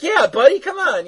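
high, sugary mock cheer; chirpy Raven. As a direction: sing it out like a cheery girl. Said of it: "she's like".

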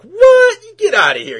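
loud, high-pitched mock shout of disbelief; acted-out shocked yelp, easing off at the end; incredulous outburst. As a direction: blurt it out like an astonished kid retelling. Retelling Virgil's disbelief at claim.

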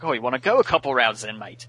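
quick, cocky Australian bloke picking a fight; Australian accent, spoiling for a brawl; mock tough Australian challenge. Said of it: "Good accent there".

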